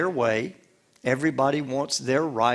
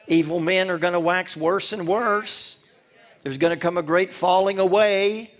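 raised, higher preaching proclamation; preaching louder; 5 semitones higher, 4 dB louder than usual. Acted. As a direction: call it out like a prophet warning the church. Preaching scripture at full force.

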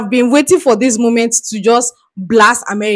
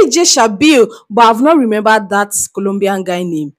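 ordinary delivery

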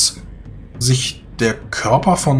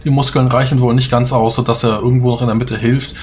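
usual speaking voice

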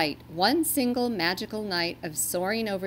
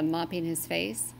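usual speaking voice

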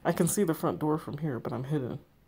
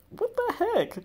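high, startled squeal of disbelief; shocked squeal; slower than usual. Real, not acted. Player shrieks at the cow-headed body, startled high squeal.

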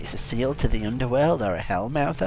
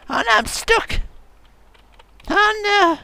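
high, loud wail of dismay; squealed mock distress; mock panic; 7 dB louder, slower than usual. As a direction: cry out as if trapped. Stuck while driving in game.

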